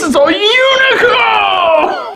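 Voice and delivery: high, slow mock announcer shout; excited shout. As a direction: belt it out like a cheesy movie title. Mocking the retro opener.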